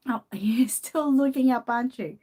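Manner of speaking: laughing tease. Real, not acted. Teasing the pet looking up.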